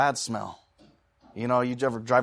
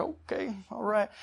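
uneasy muttered reaction; 8 semitones higher, 7 dB quieter, slower than usual. Retold or imitated. Muted reaction to hollered news.

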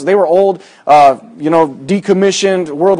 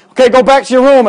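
mock barked order; 8 semitones higher, 5 dB louder than usual. Imitating roll call order.

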